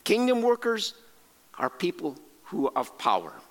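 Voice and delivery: normal talking voice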